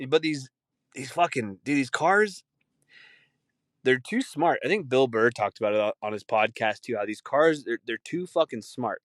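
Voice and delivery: ordinary talk, nothing marked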